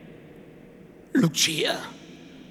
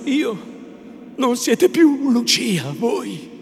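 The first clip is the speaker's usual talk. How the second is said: loud, raised-pitch protest; impassioned lover's reproach; hurt indignation; 7 semitones higher, 7 dB louder than usual. A narrator's reading. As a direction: throw the questions back, wounded. Renzo indignant at Lucia's question.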